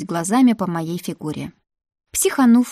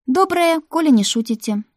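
a much higher, airy mock-cheerful greeting; breezy nonchalance; 7 semitones higher than usual. A narrator's reading. Olya answers the brooding boys lightly, breezy and carefree. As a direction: toss it off as if nothing happened. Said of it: "как ни в чём не бывало".

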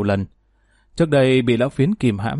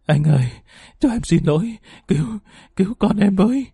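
higher, voice straining as she begs for mercy; desperate pleading; 6 semitones higher than usual. A narrator's reading. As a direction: beg him, rising in panic toward the end. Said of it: "cố lê gối đến van xin". (van xin tuyệt vọng, giọng cao dần)

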